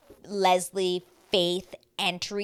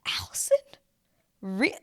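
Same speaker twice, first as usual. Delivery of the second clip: questioning disbelief; 7 semitones higher than usual. Retold or imitated. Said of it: "I said".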